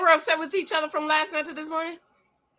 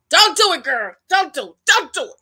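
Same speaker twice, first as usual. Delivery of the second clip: louder, punchy repeated plea; loud scolding warning; 4 semitones higher, 7 dB louder than usual. Real, not acted. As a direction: warn her off in loud bursts. Scolding woman on screen.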